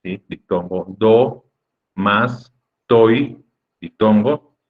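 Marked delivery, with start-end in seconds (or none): 0.9-3.4 "Do, mas, toi" as isolated syllables with pauses; separate, evenly spaced "Do, mas, toi". Shown as a demonstration. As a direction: give each of "Do, mas, toi" its own beat. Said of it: "Se divide en nueve sílabas".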